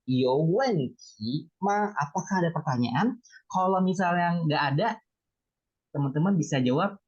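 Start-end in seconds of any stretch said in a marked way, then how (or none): 0.0-1.9 very slow, word by word; slow teaching demonstration; slow model phrase. Shown as a demonstration. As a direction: lay out the question slowly for beginners. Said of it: "ma-nya diletakkan di belakang".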